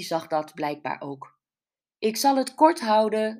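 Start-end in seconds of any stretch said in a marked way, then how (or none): 2.0-3.4 higher, louder call to the crowd. A narrator's reading. Newcomer addresses drifting villagers in the courtyard, calling out. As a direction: raise your voice over the chatter. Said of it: "riep ze".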